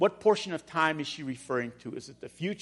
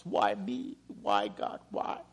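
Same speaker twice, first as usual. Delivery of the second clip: quiet lament; 8 semitones lower, 6 dB quieter, slower than usual. Retold or imitated. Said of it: "grieving, mourning".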